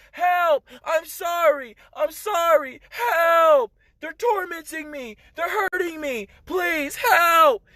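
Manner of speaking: high, desperate imitated cries for help; tormented screaming. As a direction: call out pleading like souls in torment. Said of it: "these are the sounds I was hearing".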